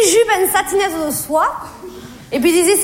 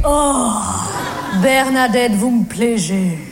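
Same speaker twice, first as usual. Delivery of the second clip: low, slowing smitten man; Jean-Loup; 6 semitones lower, slower than usual. Retold or imitated. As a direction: drop low and slow to confess attraction. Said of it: "Tout à coup, il me fait". (voix grave d'homme sous le charme)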